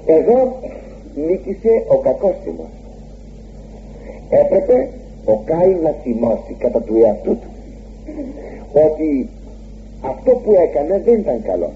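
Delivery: usual speaking voice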